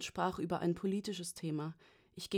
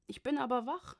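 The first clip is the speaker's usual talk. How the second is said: higher-pitched, lightly voiced drowsy old father; sleepy; 6 semitones higher than usual. A narrator's reading. Father just woken by his daughter's call, sleepy. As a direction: say it as if about to nod off. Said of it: "sagte er so schläfrig".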